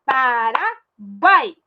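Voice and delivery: Paraguai stretched very slowly as one flow; slow demo. Shown as a demonstration. Teacher stretching Paraguai so kids hear the triphthong. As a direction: draw out Paraguai, keep it together. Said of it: "Não separa, ó".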